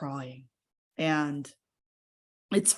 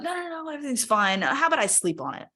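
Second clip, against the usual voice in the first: quick, high, mock-breezy brush-off; dismissive self-reassurance; 8 semitones higher, faster than usual. Woman retelling how she talked herself out of it, dismissive. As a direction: rattle it off high, waving the worry away.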